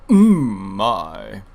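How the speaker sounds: lightly voiced Zarbon, drawn out; a hint of a preening villain. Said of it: "I do like making fun of Zarbon's voice".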